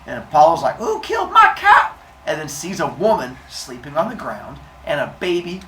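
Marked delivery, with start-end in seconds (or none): 0.8-1.9 high, loud cry of fury; hammed-up horrified anger; outraged shout. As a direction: yell it in shrieking outrage. Apollo finds his cow shredded.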